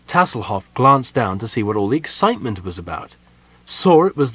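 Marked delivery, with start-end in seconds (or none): none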